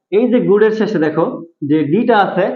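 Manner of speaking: normal talking voice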